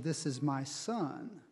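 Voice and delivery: slow, measured divine pronouncement; solemn pronouncement with long pauses between phrases; voice from the cloud. Acted. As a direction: speak as God from the cloud, drawing each phrase out. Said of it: "a voice from the cloud said".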